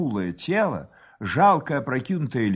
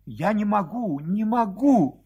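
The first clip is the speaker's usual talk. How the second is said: distraught cry; 6 semitones higher than usual. A narrator's reading. Wife sobbing, face in hands, distraught cry. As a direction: wail it higher, slowing on the repeat. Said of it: "кричала жена".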